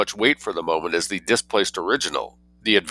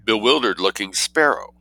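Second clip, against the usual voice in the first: ordinary delivery, nothing marked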